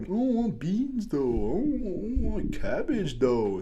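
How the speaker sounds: put-on high, slow whiny child; whiny picky kid. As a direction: whine like a picky eater. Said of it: "I was one of those kids like".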